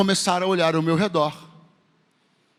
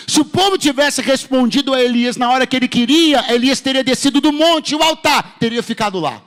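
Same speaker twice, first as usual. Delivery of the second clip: raised, loud pulpit delivery; forceful preaching; 6 semitones higher, 5 dB louder than usual. Real, not acted. Preacher explaining what Elijah would have missed, forceful preaching. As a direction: drive the point home in a higher, louder voice.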